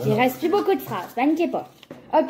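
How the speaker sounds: ordinary delivery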